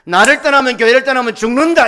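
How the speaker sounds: sustained high shout; impassioned warning. Acted. Preacher voicing God's warning of death, full-force shout. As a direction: thunder the warning without letting up. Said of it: "이 말씀을 하고".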